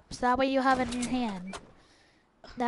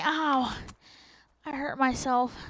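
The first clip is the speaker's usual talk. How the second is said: ordinary talk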